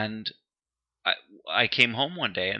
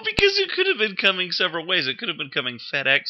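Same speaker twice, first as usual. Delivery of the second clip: ordinary talk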